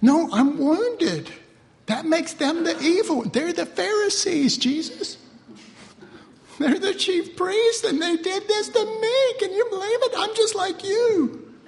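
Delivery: high, pleading mock self-pity; mock wounded whine. Preacher playing a hurt believer complaining to Jesus, high and pleading. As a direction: whine to Jesus about being wronged.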